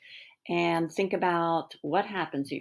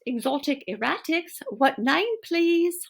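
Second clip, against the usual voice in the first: higher, louder, chirpy receptionist; 10 semitones higher, 3 dB louder than usual. Acted. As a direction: answer the phone like a receptionist. Voice answering the phone.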